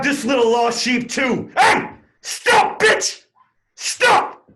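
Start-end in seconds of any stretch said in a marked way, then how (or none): 1.6-4.5 screamed much higher and louder; furious yell. Acted. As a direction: bark at her to stop. Riot cop chasing a woman.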